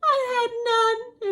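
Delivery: high, loud, put-on whiny bear; whimpering bear wail. Bear complaining his guests had all the fun, acted whimpering wail. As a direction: wail it like a hard-done-by bear. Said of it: "he whimpers and he moans and he wails".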